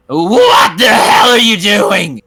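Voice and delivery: slow, loud, high witch yell; angry witch shout. Acted. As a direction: scold them furiously. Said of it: "She kinda growls at you".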